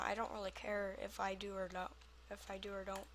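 normal talking voice

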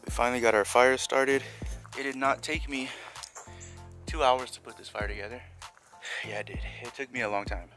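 usual speaking voice

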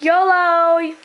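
high, loud sign-off whoop; playful shout. Pretend. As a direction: call it out high and drawn out. Shouted sign-off after goodbye.

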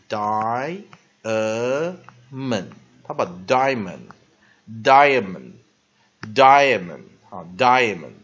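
"diamond" broken into three syllables, drawn out and loud. Shown as a demonstration. Teacher showing students how some people say it, "diamond" in three slow syllables. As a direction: stretch "diamond" slowly as die-uh-mund. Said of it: "有些人他喜欢这样念".

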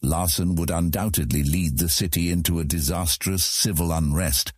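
ordinary delivery

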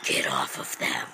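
unvoiced secretive whisper; mysterious rescuer whispers a command. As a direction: breathe it like a shadowy hero.

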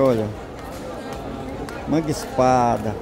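usual speaking voice

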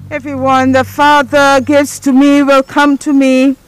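ordinary talk, nothing marked

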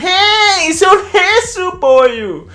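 very high, loud cartoon dad greeting; hammed-up cartoon dad call; Goofy voice. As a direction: belt it out like goofy Hessu. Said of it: "kaikki on silleen".

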